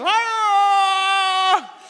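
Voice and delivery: long high imitated shriek; frightened dog wail. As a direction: wail like a petrified little dog. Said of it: "Afraid, and here she is".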